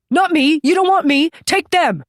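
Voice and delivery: high, loud desperate begging; terrified pleading. Acted. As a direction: beg for your life, shrieking. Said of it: "he cried".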